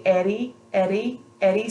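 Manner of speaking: Eddie with the tongue flicking up lightly; soft flapped d. Shown as a demonstration. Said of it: "See how the tongue just kind of flicks up?".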